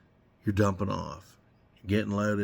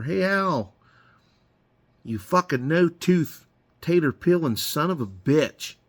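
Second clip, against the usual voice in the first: higher, jokey insulting holler over the radio; mock holler; 6 semitones higher than usual. Coal miner ribbing Al over the radio, higher mock holler. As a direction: rib a buddy on the radio. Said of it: "I'd holler".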